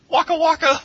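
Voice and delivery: high, loud Fozzie-style "Waka waka!"; silly clown. Acted. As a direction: squeal it like a goofy clown. Comic catchphrase shouted.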